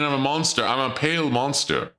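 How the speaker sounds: usual speaking voice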